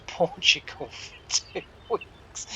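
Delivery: real laughter breaking into the words; laughing while talking. Real, not acted. Storyteller cracking up at John's Portugal excuse, laughing. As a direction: crack up mid-sentence, voice giving way to breath.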